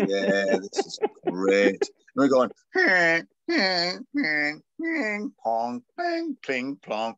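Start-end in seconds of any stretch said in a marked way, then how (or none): 0.1-1.7 slow, low, drawn-out approval; mock appreciation. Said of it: "we'd close our eyes and, like, like, nodding". 2.7-7.2 high, ringing out-of-tune plinks; imitated bad piano. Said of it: "on the piano, deliberately playing as bad as we could".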